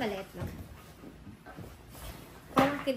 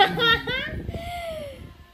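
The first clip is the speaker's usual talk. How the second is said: high laugh while being tickled; laughing burst; 13 semitones higher, 5 dB louder than usual. Real, not acted. Being tickled during procedure.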